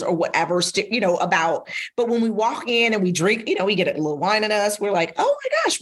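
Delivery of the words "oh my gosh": delighted surprise. Retold or imitated. Realizing we're alike.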